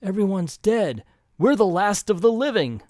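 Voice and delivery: mildly hammed-up dread; distraught mother. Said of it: "and the mother saying".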